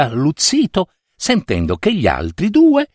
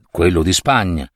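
plain reading voice

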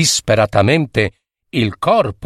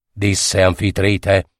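ordinary delivery

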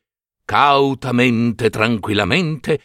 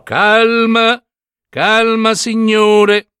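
raised, drawn-out courteous call; polite calming; 9 semitones higher, 4 dB louder, much slower than usual. A narrator's reading. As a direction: soothe the squabblers politely, voice lifted. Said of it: "disse Perseo educatamente".

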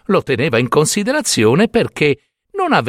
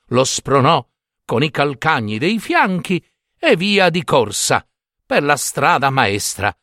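plain reading voice

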